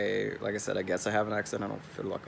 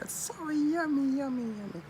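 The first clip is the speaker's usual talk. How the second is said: much higher, sing-song delight; gushing. Real, not acted. Praising San Diego taco shops, high sing-song delight. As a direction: squeal over the food.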